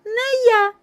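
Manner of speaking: very high, loud mouse squeak. A narrator's reading. Mouse refusing to knead dough, squeaking. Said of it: "пищить Круть".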